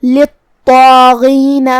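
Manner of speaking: للطاغین with a doubled heavy ط, a long heavy alif and a heavy غ from the throat, slow; heavy letters. Shown as a demonstration. Teacher shows the heavy letters, slow. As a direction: puff the mouth for the heavy ط and غ in للطاغین. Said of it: "یہ تینوں حروف کو بھاری آواز میں پڑھنا ہے".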